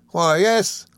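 put-on high, eager old schoolfellow; Traddles, good-tempered. A narrator's reading. Traddles welcoming his old friend, high and good-tempered. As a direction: explain earnestly in a lifted character voice. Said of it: "said Traddles".

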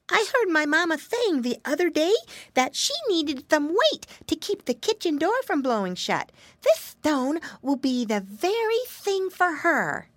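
put-on high, curious piggy boy; little pig voice. A narrator's reading. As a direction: wonder aloud like an eager piglet. Flop explains the stone.

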